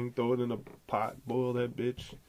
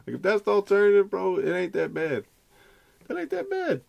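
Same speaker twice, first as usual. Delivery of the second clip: excited; 8 semitones higher, 8 dB louder than usual. Real, not acted. Laughing encouragement to drop out.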